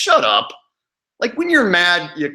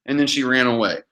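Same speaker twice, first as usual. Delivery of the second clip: ordinary talk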